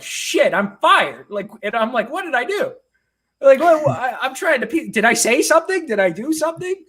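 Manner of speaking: high, panicky inner voice; replaying a moment of sudden dread; retold panic. Author seeing missed calls from his editor, panicking high. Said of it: "'cause now I'm nervous".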